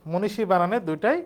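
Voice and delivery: ordinary delivery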